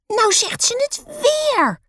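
very high, slow tattling wail; yelling. A narrator's reading. Lotte complaining to papa about Roos, yelling. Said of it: "gilt Lotte".

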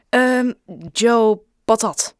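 making it up on the spot; hesitant improvised name. A narrator's reading. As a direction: stall on the um, then put the name out in pieces. Boy improvises a fake surname.

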